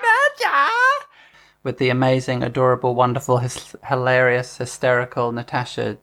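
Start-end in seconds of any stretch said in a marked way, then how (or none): 0.0-0.7 very high, drawn cry; theatrical name call. Pretend. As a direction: call it out in falsetto. Calling out a sitcom character's name.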